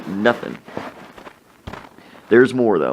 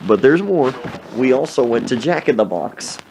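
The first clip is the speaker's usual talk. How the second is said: usual speaking voice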